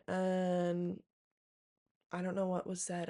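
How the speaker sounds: normal talking voice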